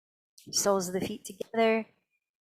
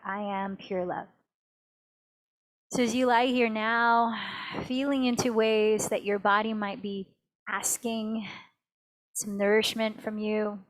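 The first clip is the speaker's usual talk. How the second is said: ordinary talk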